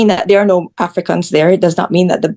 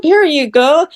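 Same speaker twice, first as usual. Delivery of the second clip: higher-pitched, playful delivery call; drone delivery announcement; 7 semitones higher, slower than usual. Acted. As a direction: act out a drone dropping off a gift.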